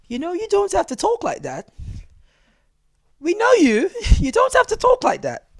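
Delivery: very high mock Englishwoman voice. English airport lady to a Jamaican, high mock-posh voice. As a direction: put on a squeaky-high polite lady.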